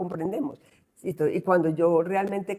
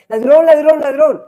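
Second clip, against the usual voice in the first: much higher, much louder mock street shout; shouting thief; 9 semitones higher, 15 dB louder than usual. Imagined people in the street shouting at him. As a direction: yell it like a crowd chasing a thief. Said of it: "empiezan a gritar".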